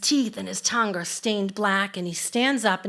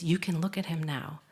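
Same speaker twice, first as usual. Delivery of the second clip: quiet reassurance; 5 semitones lower, 7 dB quieter than usual. Retold or imitated. Guide speaks quietly near gorilla.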